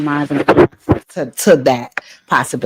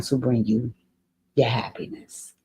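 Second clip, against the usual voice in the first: real crying; 5 semitones lower, 9 dB quieter than usual. Real, not acted. Host tearing up over the letter, crying softly. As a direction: voice breaking into tears, much softer. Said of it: "sorry for getting emotional over your letter".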